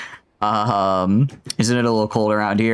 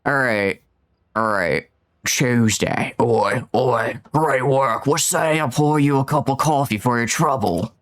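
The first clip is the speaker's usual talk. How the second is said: a British character voice for the in-game dialogue; British accent; slower than usual. Acted. Streamer voicing a game character for viewers, British accent. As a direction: read the line in a British accent with chipper warmth. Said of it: "we can do British".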